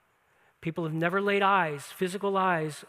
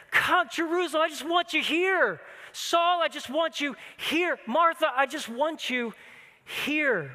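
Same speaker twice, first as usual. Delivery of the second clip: higher, aching plea of longing; pleading appeal; 8 semitones higher than usual. Retold or imitated. Jesus pleading with Jerusalem, Saul and Martha, higher and aching. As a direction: reach out to them, begging them home. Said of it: "it's a word of pleading".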